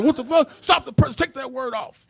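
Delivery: very high, loud stunned outburst; high alarmed boss stopping the presses; shocked boss. Acted. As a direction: yelp it like a stunned exec. Duncan Hines boss reacts.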